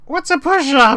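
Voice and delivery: high, loud startled creature; kobold voice. Acted. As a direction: squeak it out confused. Kobold snaps to attention confused.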